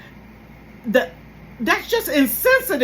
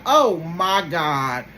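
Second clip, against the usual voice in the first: exasperated disbelief; 4 semitones lower, 4 dB louder, much slower than usual. Retold or imitated. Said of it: "I'm like".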